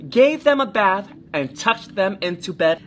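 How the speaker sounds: usual speaking voice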